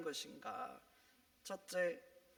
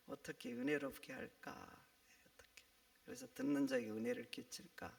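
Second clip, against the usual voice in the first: pondering; 4 semitones higher, 6 dB quieter than usual. Pretend. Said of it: "이렇게 고민해야 되죠".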